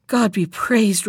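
ordinary delivery

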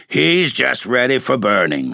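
growl of triumph. A narrator's reading. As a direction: relish it, triumphant and menacing. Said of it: "with a growl of triumph".

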